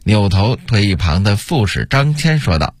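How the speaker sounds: ordinary delivery, nothing marked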